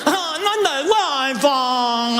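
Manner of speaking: put-on high whiny child voice, slow on the wifi line; a spoiled kid whining to mom. Nene mimado pide a mamá. (voz de nene caprichoso y quejoso)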